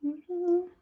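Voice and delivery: ordinary talk, nothing marked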